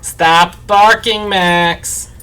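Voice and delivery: sharp call at the dog, high and slow; scolding the barking dog; scolding shout. Real, not acted. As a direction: tell the dog off loudly.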